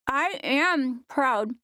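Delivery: put-on little girl talking through a mouthful; slow, high kid voice, mouth full of pizza. A narrator's reading. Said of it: "with a mouthful of pizza".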